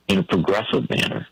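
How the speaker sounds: usual speaking voice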